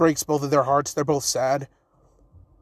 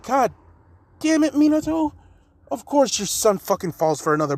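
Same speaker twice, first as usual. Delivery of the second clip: exasperated outburst; 8 semitones higher than usual. Acted. Raikage groaning about Naruto's crush, exasperated high cry. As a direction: throw up your hands at Minato.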